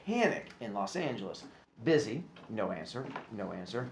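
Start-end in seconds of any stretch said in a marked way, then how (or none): none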